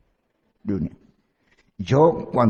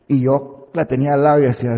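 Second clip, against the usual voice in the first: usual speaking voice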